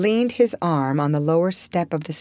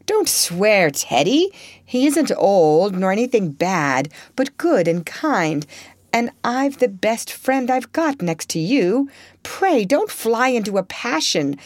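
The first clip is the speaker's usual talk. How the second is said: warm but excited; 5 semitones higher than usual. Acted. Joe defending her professor to Laurie, warm and heated. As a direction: stand up for him heatedly. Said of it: "said warmly".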